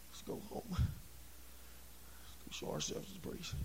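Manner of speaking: very soft, half-whispered quote; hushed mumbling. Acted. Preacher voicing men who walked off, hushed and slow. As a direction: say it under your breath, slowly. Acts out men walking off dejected.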